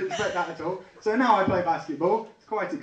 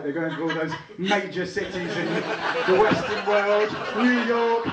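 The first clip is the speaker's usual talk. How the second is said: starting slow, rising to a high, slow shout; building mock triumph; 5 semitones higher, slower than usual. Recalling thinking the terrorists chose his town, slow building shout. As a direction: build from smug to bellowing pride. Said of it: "so I thought".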